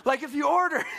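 hammed-up infomercial salesman hyping a bonus offer; infomercial guy. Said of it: "sound like an infomercial guy".

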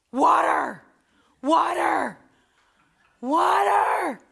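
imitated scream. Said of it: "It is a scream".

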